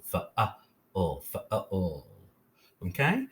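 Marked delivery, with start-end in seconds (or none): 0.0-2.1 "fa-a-o" sounded out with a short uh vowel; phonics-style "fa-a-o", drawn-out ending; short U syllable. Shown as a demonstration. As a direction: say "fa-a-o" in separate sounds, short U in the middle. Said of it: "read our last syllable with short U".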